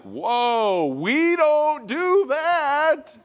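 high, drawn-out scandalized protest; shocked kid. Acted. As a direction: recoil in exaggerated childish shock. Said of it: "all of a sudden you hear stories".

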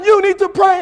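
high, loud mock shout; hammed-up righteous outburst; angry yelling. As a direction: yell it like a furious believer. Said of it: "he's yelling".

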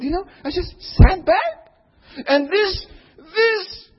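high, loud, slow preaching cry; fervent preaching. Acted. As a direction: preach it out with full force. Preaching at full force.

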